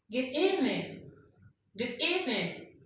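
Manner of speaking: GOOD EVENING with EVENING lifted high; rising greeting tone. Shown as a demonstration. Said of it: "use of the rising tone is for greeting".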